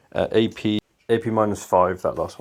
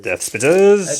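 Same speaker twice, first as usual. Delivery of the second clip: dramatic announcement; 6 semitones higher, 6 dB louder, slower than usual. Acted.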